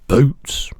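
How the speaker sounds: big, slow, booming giant voice; the giant's voice. Acted. Giant correcting the girl, big booming voice.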